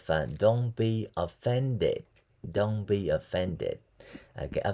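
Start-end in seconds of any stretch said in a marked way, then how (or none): 0.4-3.7 Don't be offended drawn out; slow model. Shown as a demonstration. Teacher slowly drilling Don't be offended for class. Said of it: "teacher demo, slow".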